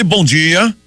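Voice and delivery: usual speaking voice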